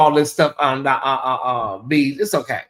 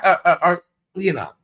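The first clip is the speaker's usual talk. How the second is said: ordinary talk, nothing marked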